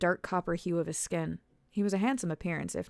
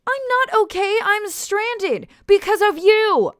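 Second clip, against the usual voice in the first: much higher, very loud, slow shout; furious shout; 14 dB louder, slower than usual. A narrator's reading. Alice screams at Oswin for stranding her, furious. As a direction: blame him at the top of her lungs.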